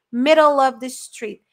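"Middle of" joined smoothly, slowly; linked pronunciation. Shown as a demonstration. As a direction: link the L into "of". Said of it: "o som do L emenda no O do of". ("Middle of" emendado, devagar)

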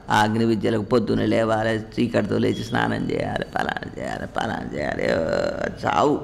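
low, dreary rundown of chores; dismissive listing. Acted. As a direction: reel it off in a droning undertone. Reels off tedious ritual chores dismissively.

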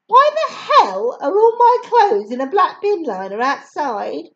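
indignant parent outburst; annoyed shout. A narrator's reading. Mum shouting about clothes.

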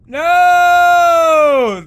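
high, loud cry of dismay; dismayed shout. Real, not acted. Gamer crying out as he is stopped. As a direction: cry out as it goes wrong.

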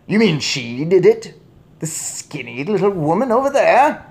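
loud, high, rising question; incredulous shout. A narrator's reading. Father points at woman, shouting incredulously. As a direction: question it in outraged disbelief. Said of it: "shouted Mister Jenkins".

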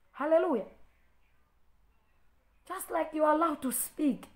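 ordinary talk, nothing marked

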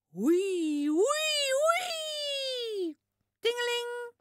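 high loud siren wail then ringing bell sounds. Retold or imitated. Fire engine passing the children, high loud siren and bell.

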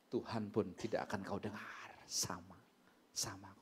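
low, near-whispered admonition; hushed warning. Real, not acted. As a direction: drop to a grave hush. Sobering warning after acted quarrel.

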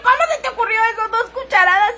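high, fast, incredulous exclaim; retelling her own stunned reply to a friend; shocked disbelief. Retold or imitated. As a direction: react as if you can't believe what you heard.

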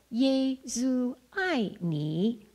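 "耶稣爱你" said very slowly; slow Chinese prayer. Shown as a demonstration. Leading a prayer in Chinese, very slowly. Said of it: "repeat after me".

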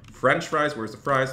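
ordinary talk, nothing marked

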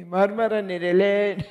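hammed-up mother in disbelief; village mother. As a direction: shriek it like a baffled village mother. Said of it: "Anamın hareket".

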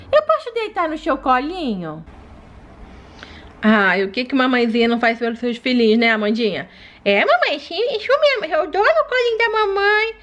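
put-on a small child talking to her mom; baby doll voice. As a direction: play the sick baby begging to play.

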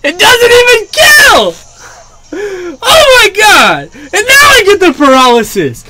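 screaming, high-pitched real rage; loud furious yelling that eases down at the end; heated. Real, not acted. Player getting paralysis when it doesn't matter, heated screaming. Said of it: "I'm so heated".

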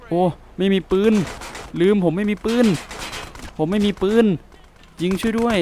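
high, urgent panicked cry; panicked no gun. Acted. Unarmed guy begging his partner for cover, panicked high cry. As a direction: yelp it out like you're unarmed and scared.

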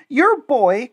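high, loud wail; crying out. A narrator's reading. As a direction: burst out at the father. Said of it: "cried she".

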